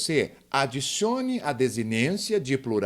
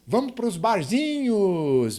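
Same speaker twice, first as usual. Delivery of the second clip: mocking common error; 6 semitones higher, 3 dB louder, slower than usual. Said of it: "fica todo mundo falando isso".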